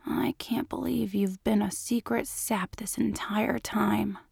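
soft teasing whisper. A narrator's reading. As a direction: whisper the tease close up. Teasing lover in bed.

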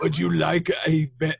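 lightly put-on film-character voice; lightly voiced movie villain. Co-host quoting a film line to his friends, light character impression.

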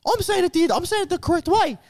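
high mock voice of the friend. Imitating friend insisting on his pronunciation, high mock voice. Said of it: "He's like".

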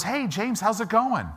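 high, chirpy, cheery greeting; dorky neighbor. Retold or imitated. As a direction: play the oblivious happy neighbor. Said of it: "here comes this dorky neighbor".

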